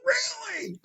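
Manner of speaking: high excited shriek of disbelief; thrilled squeal. Retold or imitated. Prostitute thrilled at the film offer, shrieking high. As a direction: play her as overjoyed, squealing.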